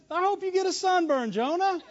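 high-pitched petty taunt; mock spite. Preacher imagines us scolding sulking Jonah, high mock spite.

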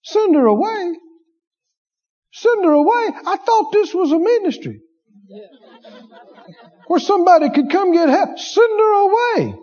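high, offended, incredulous repeating; indignant huff. Retold or imitated. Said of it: "They'd have thought".

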